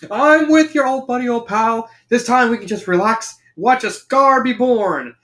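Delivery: high-pitched cartoon slacker; Shaggy. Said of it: "Shaggy leans his feet".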